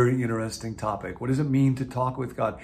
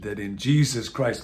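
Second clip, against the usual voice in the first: ordinary talk, nothing marked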